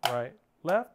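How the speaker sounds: evenly spaced words on the beat; measured, metronomic drum count; rhythmic sticking count. Shown as a demonstration. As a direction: call out each hand in steady time. Calling sticking in time with playing.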